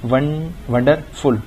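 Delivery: slow false start, then "wonderful" as wonder and फुल; split into syllables. Shown as a demonstration. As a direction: break "wonderful" before फुल. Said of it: "उसकी भी दो syllable आ गए". ("Wonderful" को wonder और फुल में तोड़कर)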